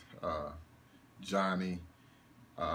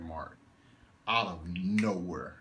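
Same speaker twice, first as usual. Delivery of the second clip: normal talking voice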